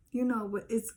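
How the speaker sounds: ordinary delivery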